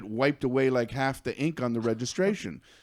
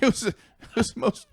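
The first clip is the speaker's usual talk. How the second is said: ordinary talk, nothing marked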